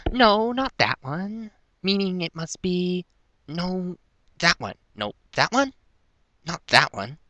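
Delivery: high-pitched character trying keys; high voice, thinking aloud; character muttering to herself. A narrator's reading. Character tries keys one by one.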